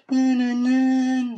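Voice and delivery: high, drawn-out hummed sting; imitated dramatic music. Telling a friend about a slow TV scene, imitated dramatic music. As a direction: hum a long, loud suspense sting.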